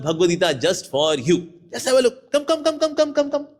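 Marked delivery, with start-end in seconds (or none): 1.7-3.5 enthusiastic invitation. Shown as a demonstration. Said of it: "उत्साहपूर्वक मिलना चाहिए".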